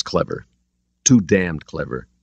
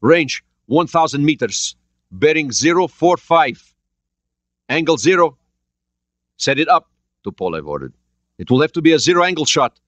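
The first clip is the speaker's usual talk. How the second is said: urgent sonar report; 9 semitones higher, 5 dB louder than usual. A narrator's reading. Soviet crew sets up shot.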